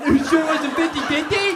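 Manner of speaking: shrieked high-pitched taunt; mocking shout. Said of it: "they make fun of me".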